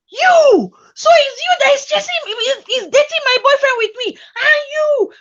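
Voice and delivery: furious confrontation. Retold or imitated. Girlfriend catching the other girl, furious high yelling. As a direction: scream at the rival, half laughing.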